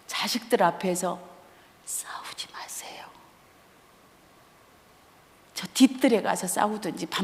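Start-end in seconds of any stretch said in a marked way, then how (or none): 1.8-3.0 soft whispered plea. Real, not acted. Urging parents not to fight before children, whispered plea. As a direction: beg them under your breath.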